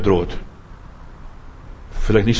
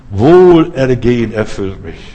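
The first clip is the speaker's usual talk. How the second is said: proclaiming; 4 dB louder than usual. Acted. Declaring the believer's confession.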